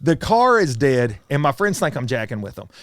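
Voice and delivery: normal talking voice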